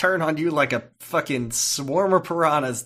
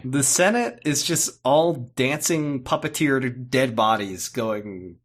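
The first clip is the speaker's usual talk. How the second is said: ordinary talk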